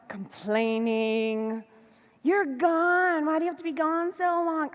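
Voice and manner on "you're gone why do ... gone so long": mock whiny complaint. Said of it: "I'm not bitching".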